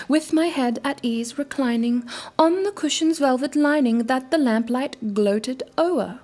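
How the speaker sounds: measured, brooding recital of a gothic poem; dramatic poem reading. A narrator's reading. Reading a favorite poem to viewers, dramatic recital. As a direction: read it like a candlelit recital. Reads The Raven aloud.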